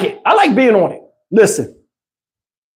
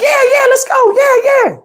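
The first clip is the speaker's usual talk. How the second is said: put-on excited kid. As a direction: squeal it like a thrilled little girl. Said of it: "So my daughter's like".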